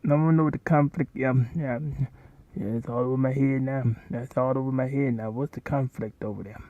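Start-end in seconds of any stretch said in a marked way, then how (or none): none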